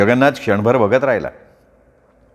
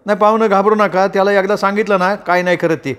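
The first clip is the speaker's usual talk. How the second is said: higher village servant's voice, calming the guest; rustic farmhand reassuring; 8 semitones higher than usual. Acted. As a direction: soothe a nervous visitor, countrified. (पाहुण्याला धीर देणारा गडी, उंच गावरान आवाज)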